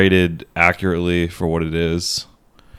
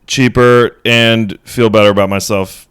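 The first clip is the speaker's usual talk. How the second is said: excited rant; 4 semitones higher, 7 dB louder than usual. Real, not acted. Heated rant about sandwiches.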